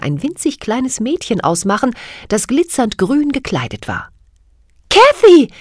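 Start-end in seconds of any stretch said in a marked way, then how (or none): 4.9-5.5 high, loud joyful call; overjoyed. Acted. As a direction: cry out with delight. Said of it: "rief sie überglücklich".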